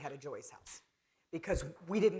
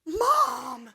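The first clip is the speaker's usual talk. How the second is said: high, loud shocked yelp of Mom!; scandalized daughter shouting at her mother; shocked outcry; 10 dB louder than usual. Retold or imitated. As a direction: cry out at mom in disbelief. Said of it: "I go".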